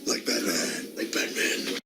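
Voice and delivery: Batman voice. Acted. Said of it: "Batman impression".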